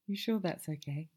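ordinary delivery

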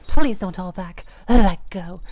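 urgent plea. Acted. Begging partner in roleplay.

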